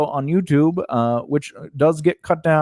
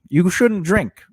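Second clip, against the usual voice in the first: normal talking voice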